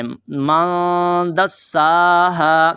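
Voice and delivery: दस्साहा with the सा stretched out; long vowel. Shown as a demonstration. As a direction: hold "saa" in दस्साहा, slow recitation. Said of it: "आपने इसको लंबा करना है".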